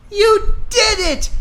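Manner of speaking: very high excited squeal; happy cheer. Acted. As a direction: cheer him on with joy. Hannah cheers happily.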